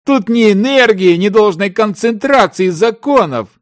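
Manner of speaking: high-pitched, heated scolding; exasperated tirade. A narrator's reading. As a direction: berate your partner for his foolishness. Partner angrily rejects the idea. (раздражённая тирада на повышенных тонах)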